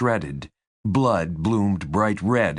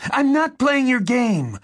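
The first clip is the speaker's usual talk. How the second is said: high-pitched angry refusal; spat out in fury; 4 dB louder than usual. A narrator's reading. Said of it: "she spat out".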